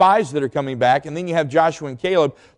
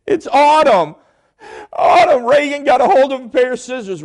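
imitating wife bawling; 9 semitones higher, 5 dB louder than usual. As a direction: drag it out like she can barely speak. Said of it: "she was bawling, crying".

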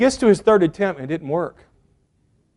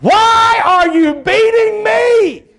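high, loud drawn-out yell; outraged donkey; 6 dB louder, much slower than usual. Acted. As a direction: shout it as a beaten donkey protesting. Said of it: "the donkey is like".

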